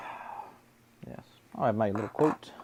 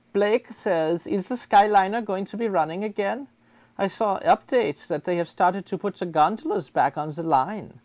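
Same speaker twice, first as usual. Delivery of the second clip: put-on German accent; hammed-up German-accented English. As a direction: talk like a German host. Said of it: "we are speaking German".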